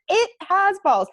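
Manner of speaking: high, loud gasping yell; shrieked first words after birth; screaming. Retold or imitated. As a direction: blurt it out in shock. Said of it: "very first thing out of my mouth".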